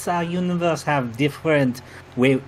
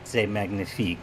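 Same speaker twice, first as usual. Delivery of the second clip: mock-dramatic reveal in a hammed-up French accent; a sly French-accented confession; host reveals he is from another universe, in mock French; fake French accent; 5 semitones lower, 8 dB quieter, faster than usual. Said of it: "outrageous accent".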